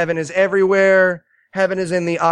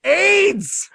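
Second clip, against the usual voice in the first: much higher, strained comic yell; exasperated cry; 13 semitones higher than usual. Retold or imitated. Viewer listing real problems to the movie, exasperated yell. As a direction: yell it in mock outrage.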